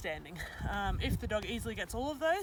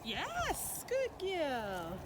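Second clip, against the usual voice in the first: praise; 6 semitones higher, much slower than usual. Real, not acted. Praising dog after cue.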